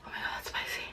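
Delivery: breathless whispered gasp; real whispered reaction to the heat. Real, not acted. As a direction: barely voice it, mouth burning. Overwhelmed by spice heat.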